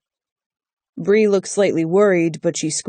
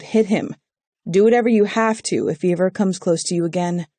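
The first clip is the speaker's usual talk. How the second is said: plain reading voice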